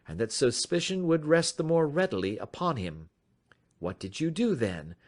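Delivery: polite and in command; composed detective. A narrator's reading. Detective inviting the stranger to talk, lightly voiced calm.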